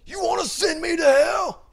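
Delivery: very high, loud shout, hammed-up; mock yelling. [name] defying God in the chapel, mock yelling. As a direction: bellow it like an overacted movie climax. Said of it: "he starts just yelling at God".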